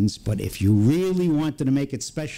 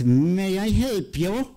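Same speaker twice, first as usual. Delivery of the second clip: put-on slow, higher European-accented greeting; thick European accent; 6 semitones higher, slower than usual. Shop woman greets visitors at the bell, thick European accent. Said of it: "in this thick European accent".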